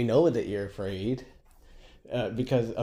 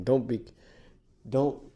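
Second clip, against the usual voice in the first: ordinary talk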